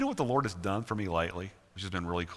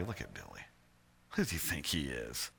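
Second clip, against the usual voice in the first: low, hushed aside; mock whisper; 11 semitones lower, 9 dB quieter than usual. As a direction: imitate a catty murmur to a neighbour. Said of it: "I wanna whisper to somebody".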